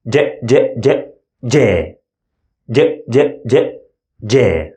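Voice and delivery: J with a D before it, said many times; repeated J drill. Shown as a demonstration. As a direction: drill the dj sound over and over. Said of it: "repeat the D sound many times".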